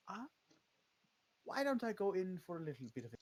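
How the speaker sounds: halting vampire voice with jumps in pitch; hesitant vampire. Acted. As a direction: play a vampire feeling their way to an excuse. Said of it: "the vampirus actually goes".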